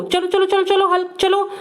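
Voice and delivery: cheering Hulk on. Pretend.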